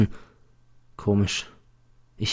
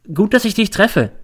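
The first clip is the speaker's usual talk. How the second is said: much higher, friendly greeting; 10 semitones higher than usual. A narrator's reading. Teacher greeting a pupil on the street, friendly. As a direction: lift the voice as if pleased.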